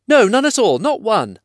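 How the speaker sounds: high, loud frightened hobbit; squeaky, scared little voice; panicked denial. Acted. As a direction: hastily deny it in a panic. Bilbo hastily takes back answer.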